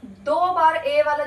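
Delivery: spoken slowly, the words drawn out; slow down to mark the new rule; slow emphatic. Shown as a demonstration. Teacher slowly introducing the second sound. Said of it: "दूसरा देखो क्या है".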